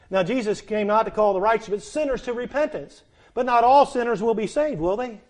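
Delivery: loud, high preaching with every phrase driven hard; thundering pulpit delivery; full-force preaching. Acted. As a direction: preach it out to the whole room.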